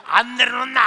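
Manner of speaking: acted angry holler; mother's protesting scream. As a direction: holler to be put down. Mother on son's back yells.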